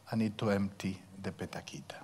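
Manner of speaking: breathy whispered aside. Acted. Preacher whispering to the congregation, hushed.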